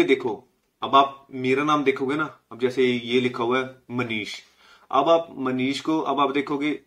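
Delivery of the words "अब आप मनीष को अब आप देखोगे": usual speaking voice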